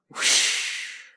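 soft, voiceless whoosh of flames; breathy fire whoosh. Acted.

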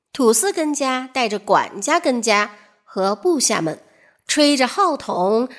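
ordinary delivery

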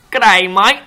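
broad Australian accent. Shown as a demonstration. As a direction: lay the Australian accent on thick. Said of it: "澳洲口音一点吗？".

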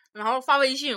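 normal talking voice

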